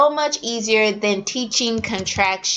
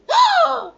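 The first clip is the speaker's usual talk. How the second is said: excited gasp; 3 dB louder than usual. Shown as a demonstration. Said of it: "mock surprise before drill".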